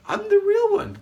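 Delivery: excited self-proclamation. Retold or imitated. Self-styled guru insisting on being genuine, excited. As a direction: proclaim it in a high voice. Acting out own self-belief.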